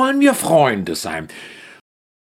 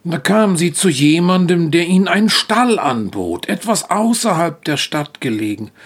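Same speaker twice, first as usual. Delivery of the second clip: usual speaking voice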